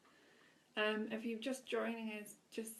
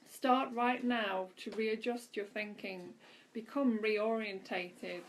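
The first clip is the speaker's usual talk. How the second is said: louder, emphatic inspirational reading; devotional reading; 7 dB louder than usual. Acted. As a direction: read each line as an uplifting message to the listeners. Reads daily devotional aloud.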